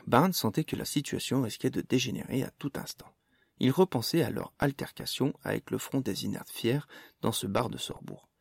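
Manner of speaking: plain reading voice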